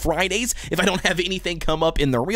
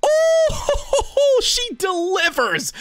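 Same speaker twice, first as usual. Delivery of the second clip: high, shouting with laughter at the pull; ecstatic laughing shout; 14 semitones higher, much slower than usual. Real, not acted. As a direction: burst out laughing in delight. Gacha pull reaction, laughing shout.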